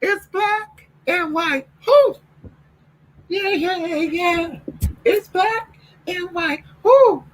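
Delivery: very high, slow imitation with whoops; high falsetto yeah yeahs and whoops. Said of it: "in that [name] video".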